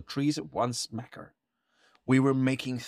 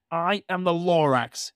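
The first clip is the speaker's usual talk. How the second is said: coughing Lorax; 7 semitones higher, 4 dB louder than usual. A narrator's reading. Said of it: "he coughed and he whiffed".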